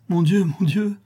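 high, loud wail of despair; desperate exclamation. A narrator's reading. Notary unable to find the answer, desperate. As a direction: cry out at wits' end. Suitor at his wits' end.

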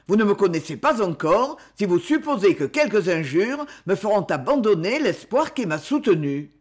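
usual speaking voice

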